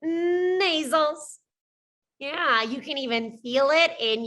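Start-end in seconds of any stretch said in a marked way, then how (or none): 0.0-1.4 nasals said slowly with lots of nose; drawn-out nasal demo. Shown as a demonstration. Teacher modelling the word for learners, slow and nasal. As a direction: stretch the word nasals as a demonstration. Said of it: "Touch your nose".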